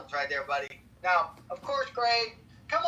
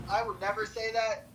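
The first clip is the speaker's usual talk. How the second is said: normal talking voice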